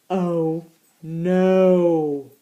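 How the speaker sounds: low, drawn-out "oh no"; playful storybook dismay; mock dismay. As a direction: groan it in fake alarm. Dismay at the mouse's return.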